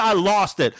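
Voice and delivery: ordinary delivery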